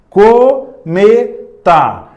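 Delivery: Cometa, drawn out slowly to show the co sound. Shown as a demonstration. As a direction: stretch out Cometa syllable by syllable. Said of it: "com o som da palavra cometa". (Cometa bem pausado, destacando o co)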